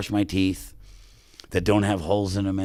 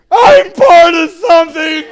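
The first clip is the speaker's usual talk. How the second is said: much higher, louder, slow proud shout of "something"; joyful shout; 15 dB louder, slower than usual. Retold or imitated. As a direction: shout "I'm part of something" at the stranger, slowly. Answering the woman, overjoyed.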